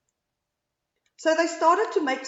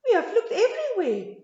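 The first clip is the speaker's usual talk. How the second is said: fox laugh; 10 semitones higher, 5 dB louder, faster than usual. A narrator's reading. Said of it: "said the noisy foxes".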